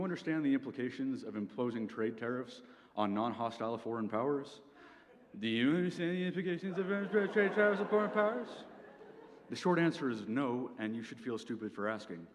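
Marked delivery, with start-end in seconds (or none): 5.4-8.5 high, dopey parroting of the question; dumb voice. Shown as a demonstration. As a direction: repeat it back like a clueless dimwit. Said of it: "repeating the question you've just been asked in a dumb voice".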